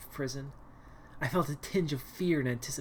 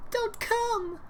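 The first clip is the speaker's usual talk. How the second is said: shrieked much higher; scream. Acted. Cornered captive screaming at the approaching stranger. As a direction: scream it at the intruder. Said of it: "I screamed".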